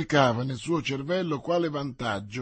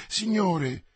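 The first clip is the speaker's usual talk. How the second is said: plaintive; 7 semitones higher, 3 dB louder than usual. A narrator's reading. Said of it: "in tono lamentevole".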